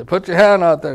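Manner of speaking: put-on a nun telling the boy to hold out his hand; strict nun. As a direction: bark it like a stern nun with a ruler. Nun with ruler.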